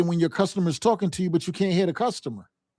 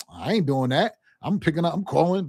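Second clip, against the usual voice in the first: normal talking voice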